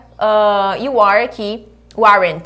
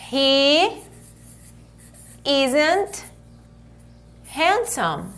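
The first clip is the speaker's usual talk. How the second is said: drawn out with long pauses between words; slow model sentence; much slower than usual. Shown as a demonstration. Teacher showing the contracted form slowly. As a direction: lay each word down separately. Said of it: "ou se eu falar".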